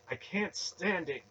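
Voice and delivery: high, loud, anguished rage; raging. A narrator's reading. Young man enraged by the sacrifice, high slow outburst. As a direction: drag it out in high-pitched fury. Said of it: "Garion raged silently".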